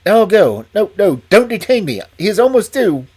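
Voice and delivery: a frightened man hurrying to warn; agitated nervous manager. Acted.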